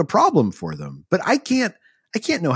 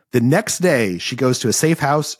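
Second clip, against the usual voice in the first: normal talking voice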